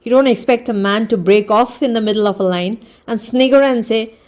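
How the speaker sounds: ordinary delivery, nothing marked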